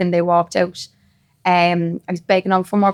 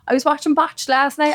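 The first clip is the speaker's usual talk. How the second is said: ordinary talk, nothing marked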